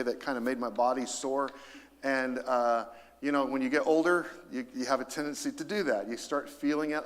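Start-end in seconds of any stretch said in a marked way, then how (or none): none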